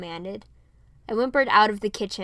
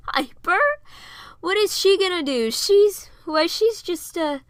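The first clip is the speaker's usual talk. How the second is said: higher, louder, mocking then unsure; scoffing, trailing off; 9 semitones higher, 7 dB louder than usual. A narrator's reading. Eugene mocks the idea of Piper fighting, then trails off in doubt. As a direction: laugh it off, then lose the thread. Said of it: "He drifted off".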